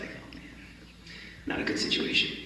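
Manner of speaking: hushed, sinking mutter of dismay; dismayed under breath. Retold or imitated. Joe sees the FBI agents in court, muttering in dismay. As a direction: let it drop to a near whisper as hope sinks.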